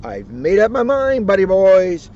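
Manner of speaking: high, loud Tigger announcement; Tigger announcing. Acted. Tigger announcing his decision to his friends, loud. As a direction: proclaim it to the gang. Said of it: "Tigger said".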